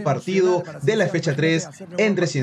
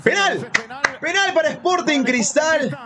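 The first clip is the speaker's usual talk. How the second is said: excited shout; 7 semitones higher than usual. Real, not acted. Penalty given to his team.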